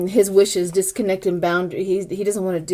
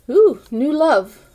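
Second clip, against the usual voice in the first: teasing ooh; 6 semitones higher, 4 dB louder, slower than usual. Real, not acted. Teasing over a tarot card.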